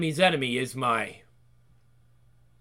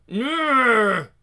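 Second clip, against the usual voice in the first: effort grunt; 7 semitones higher than usual. Acted. Effort cry.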